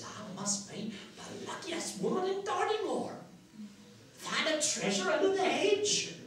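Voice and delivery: put-on high-pitched old countrywoman, marvelling; old woman. Washerwoman finds gold under the hedge, high old-woman wonder. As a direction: marvel at your luck in a high crone's voice. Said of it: "how old and worn out she is".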